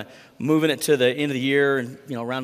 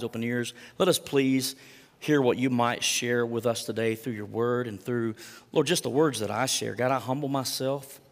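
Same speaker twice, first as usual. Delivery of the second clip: soft, low, earnest prayer; hushed, lowered praying voice; 4 semitones lower, 5 dB quieter than usual. Real, not acted. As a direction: lead the congregation humbly in prayer. Leads the congregation in prayer.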